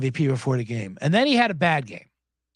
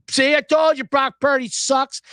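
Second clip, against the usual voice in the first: slow, higher mock gloating; Micah Parsons impression; 6 semitones higher, 4 dB louder than usual. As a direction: draw out the told-you-so to mock him. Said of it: "Micah Parsons took a shot at".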